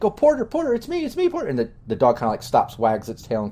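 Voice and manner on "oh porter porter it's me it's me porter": put-on high, pleading girl's voice; young woman soothing her barking dog; Penny calling her dog. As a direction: call the dog sweetly, pitched way up. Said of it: "she's just like".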